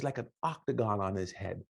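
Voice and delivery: ordinary delivery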